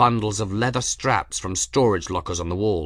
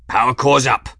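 growled; 3 semitones higher, 8 dB louder than usual. A narrator's reading. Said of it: "Journeyman growled".

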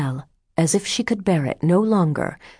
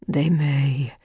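slow, gloomy assent. A narrator's reading. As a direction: concede it darkly. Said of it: "said Adele gloomily".

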